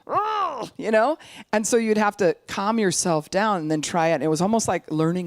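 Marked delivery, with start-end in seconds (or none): 0.1-0.7 high-pitched "ugh" of frustration; frustrated groan. Acted. Giving up on a hard conversation, frustrated groan. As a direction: let out a high exasperated groan.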